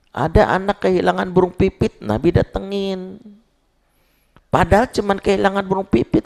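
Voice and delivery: high, loud impassioned sermon; fiery preaching. Acted. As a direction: preach it with full force to the congregation. Preacher raises voice at full force. (ceramah berapi-api dengan suara tinggi)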